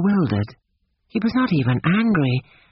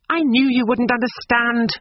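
a cross, raised retort; quite crossly; 8 semitones higher than usual. A narrator's reading. Lucy answering Cecil crossly, voice raised high. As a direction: snap it back at him, put out. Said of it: "said Lucy quite crossly".

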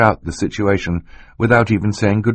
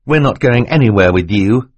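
higher, louder refusal; defiant boy; 4 semitones higher, 5 dB louder than usual. Acted. Klaus refusing to leave with Stefano, mildly raised defiance. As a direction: stand your ground.

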